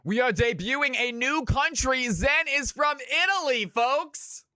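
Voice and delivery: high-pitched hype reveal; excited announcement. Real, not acted. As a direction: sell it like big news to the crowd. Hyping new Italian player.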